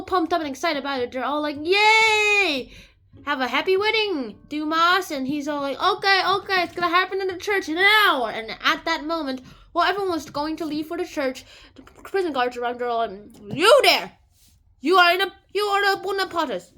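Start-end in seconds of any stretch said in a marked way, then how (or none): 1.6-5.1 loud, high celebratory whoop; mock cheering. Wedding guests cheer. 5.8-8.3 high, loud, hurried eagerness; excited groom. Acted. Excited groom answers guests. 13.5-16.7 loud, high accusing shout; guard's challenge. Acted. Guards arrest Dantes.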